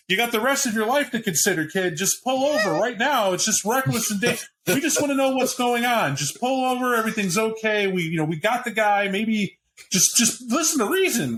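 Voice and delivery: high, pleading calls to pull over; psychologist on a bullhorn. Acted. Police psychologist pleads with the fleeing biker over the bullhorn. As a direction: talk a reckless kid down through a megaphone. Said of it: "You can hear them on this, this horn".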